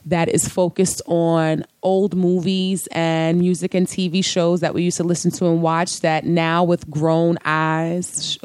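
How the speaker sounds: ordinary talk, nothing marked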